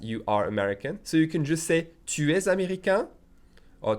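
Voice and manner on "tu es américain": "Tu es américain" high, rising on "américain"; raised-voice question. Shown as a demonstration. Teacher shows a question by tone alone, "américain" rising. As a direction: lift "américain" upward to make a question. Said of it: "raising your voice".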